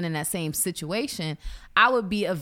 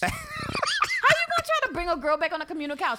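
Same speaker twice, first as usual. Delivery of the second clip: incredulous disgust; 10 semitones higher than usual. Acted. Woman appalled by girls brought to communal couch, incredulous. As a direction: squeal it in comic disbelief.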